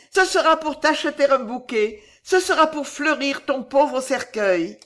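high, fervent resolve; illuminée. A narrator's reading. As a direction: vow it with a touching new hope. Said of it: "illuminée par une profonde et touchante pensée". (résolution fervente, aiguë)

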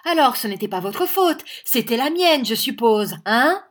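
high, loud sarcasm; bully him with a loud, jeering question; sarcastic bullying. A narrator's reading. Schoolmaster turning the blame on his victim, sarcastic bullying.

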